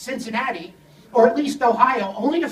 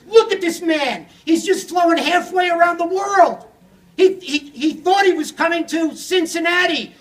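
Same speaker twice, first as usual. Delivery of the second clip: irate rant; 4 semitones higher than usual. Retold or imitated. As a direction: tear into him in mock outrage. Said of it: "I started screaming at the guy".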